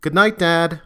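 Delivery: daughter's reply. A narrator's reading. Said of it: "It forced her to retreat before replying".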